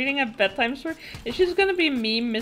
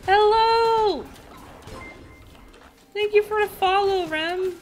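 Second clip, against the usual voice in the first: high, loud, thrilled welcome; excited greeting; 11 semitones higher, 8 dB louder, slower than usual. Real, not acted. Streamer welcoming Fuku's raid, high loud excited greeting. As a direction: burst out delighted at friends arriving.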